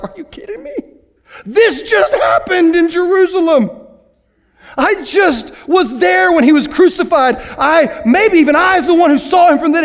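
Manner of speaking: high, loud, amazed exclamation; amazed Philip. Acted. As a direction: burst out in disbelief and joy. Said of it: "he's like".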